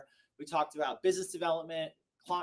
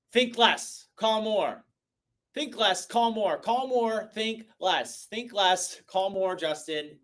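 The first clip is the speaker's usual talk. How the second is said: emphatic slogan; 6 semitones higher, 10 dB louder, slower than usual. Real, not acted. Hammering his motto home.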